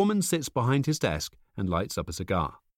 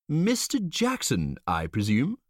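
suave stranger; 6 semitones higher, slower than usual. A narrator's reading.

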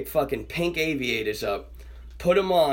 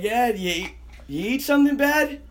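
raised, high-pitched imitation of dad's question; dad calling out; 9 semitones higher, 4 dB louder than usual. Son imitating dad asking from his room, calling out. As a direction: call it out like a dad in the next room.

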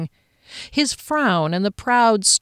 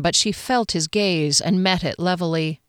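ordinary delivery, nothing marked

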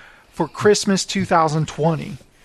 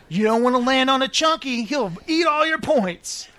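lightly mimicked game show host, pitched up; high, mock warning to a contestant; 7 semitones higher than usual. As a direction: put on a higher host voice.

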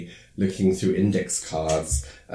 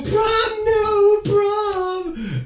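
very high, wailing protest; whiny protest; 6 dB louder, much slower than usual. Retold or imitated. Students wailing at losing prom passes. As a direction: squeal it like upset teens.

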